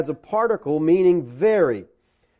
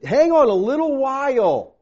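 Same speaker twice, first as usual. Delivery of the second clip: raised, higher pleading call to hold on; urging hang on; 6 semitones higher, 3 dB louder than usual. Retold or imitated. Preacher voicing the author to suffering Hebrews, raised urging. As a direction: call out the promise to sufferers, lifted.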